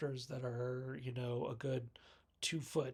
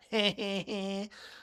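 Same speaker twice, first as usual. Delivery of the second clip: higher, put-on string of giggles; mock giggle; 5 semitones higher than usual. Podcaster voicing stoner girl after her jibe, mock giggle. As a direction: imitate a teasing movie character's titter. Imitating the stoner girl's laugh.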